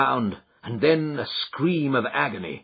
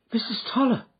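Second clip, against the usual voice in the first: higher startled exclamation; surprised cry; 13 semitones higher, faster than usual. A narrator's reading. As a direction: call her name in surprise. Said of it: "cried Miss Hunter".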